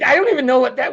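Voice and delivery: normal talking voice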